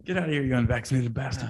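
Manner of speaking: lightly put-on voice of rude restaurant staff; mock staff voice. Imagined restaurant staff telling him off, in a lightly put-on sneer. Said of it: "they wouldn't give a shit".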